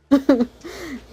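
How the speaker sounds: sudden bright laugh; real laugh breaks out. Real, not acted.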